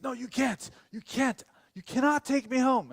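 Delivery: high, loud, insistent refusal; panicked protest. Retold or imitated. Passenger learns he's being driven home, panicked high protest. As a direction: imitate a panicked guy protesting. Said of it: "as soon as he realizes I'm taking him home".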